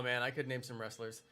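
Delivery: ordinary talk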